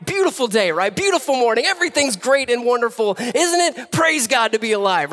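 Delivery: high, hammed-up fake cheer; mock-delighted, overdone church pleasantries; facade of everything's fine. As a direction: gush as if life is perfect. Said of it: "put this facade up of everything's fine".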